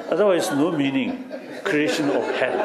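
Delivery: chuckling through the punchline; laughing. Real, not acted. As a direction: say it while still laughing.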